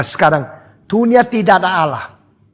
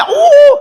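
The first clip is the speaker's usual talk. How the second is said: burst of laughter; 6 dB louder than usual. Acted. Mimicking the gods' laughter.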